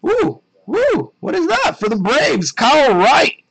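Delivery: high, loud whooping excitement; whoop, then announce the big pull loudly; excited card reveal. Real, not acted. Reacts to pulling an autograph card.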